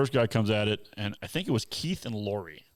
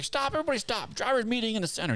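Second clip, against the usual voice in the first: much higher mock holler halting everyone; imitating a yell; 13 semitones higher than usual. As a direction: shout the stop order like a fed-up guide. Guide yelling to halt group.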